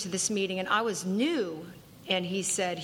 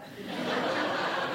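laugh. Real, not acted. Laugh after own joke.

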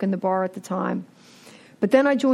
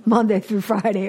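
normal talking voice

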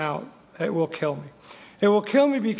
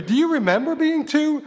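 sponsor's question; 9 semitones higher than usual. Retold or imitated. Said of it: "My sponsor said that to me".

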